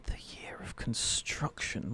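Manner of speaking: soft unvoiced whisper. Real, not acted. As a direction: whisper it, puzzling. Muttering clue again.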